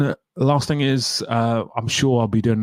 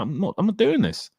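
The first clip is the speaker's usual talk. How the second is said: much higher, pleading mock refusal; hammed-up fed-up fan, higher and higher; exasperated protest; 8 semitones higher than usual. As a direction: whine it like you can't believe it. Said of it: "I was like".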